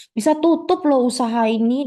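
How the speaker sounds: high, fast indignant ranting; an outraged customer at a restaurant; furious customer. Acted. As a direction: threaten to go viral, voice up high.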